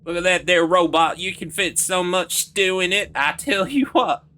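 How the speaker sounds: put-on high, loud southern US accent; hammed-up, high southern accent. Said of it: "whenever I try to do the southern voice".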